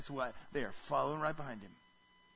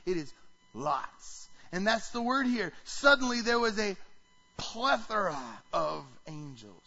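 normal talking voice

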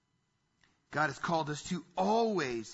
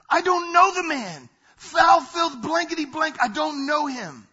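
higher, much louder, an outburst of denial; panicked denial; 8 semitones higher, 9 dB louder than usual. Retold or imitated. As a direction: shout it out, cornered and scared. Peter's panicked denial, voiced.

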